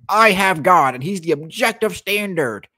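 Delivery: high, loud mocking imitation; mock the believer's smug claim; mocking opponent. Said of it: "What you're doing is you're going".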